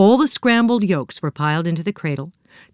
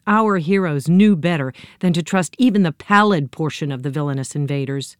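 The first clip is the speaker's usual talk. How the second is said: plain reading voice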